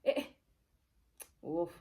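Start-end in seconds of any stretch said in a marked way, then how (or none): none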